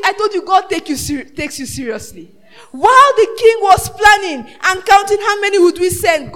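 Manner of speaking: loud, driving sermon delivery; fiery pulpit shout; preaching at full force. Acted. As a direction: hammer each phrase to the congregation.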